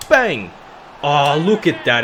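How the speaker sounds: loud, high whoop as the ball goes in; loud joy, slowing down to savour it; goal celebration. Real, not acted. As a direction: yell it like the winning goal.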